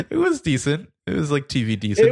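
high, laughing verdict. Real, not acted. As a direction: say it through a laugh, pitched high. Laughing at actor's accent.

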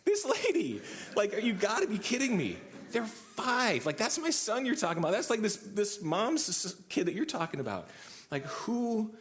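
high-pitched, incredulous retelling; hammed-up "can't believe this" protest; exasperated disbelief. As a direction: react in comic outrage, voice way up. Acting out his disbelief at her.

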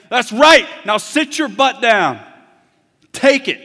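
loud, high mock rebuke; harsh scolding God. Pretend God scolding Job harshly, very loud. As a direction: bark it down at Job. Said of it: "He doesn't say".

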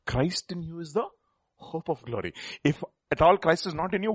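usual speaking voice

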